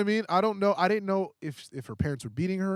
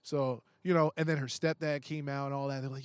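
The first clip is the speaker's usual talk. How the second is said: ordinary talk, nothing marked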